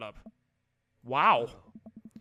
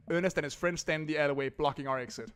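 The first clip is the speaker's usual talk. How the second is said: ordinary talk, nothing marked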